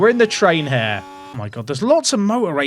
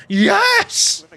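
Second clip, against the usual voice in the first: high, excited mock yell of Yes!; imitating Albon's yell; 14 semitones higher than usual. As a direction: ape the driver whooping on the radio. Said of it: "Alex Albon's just there going".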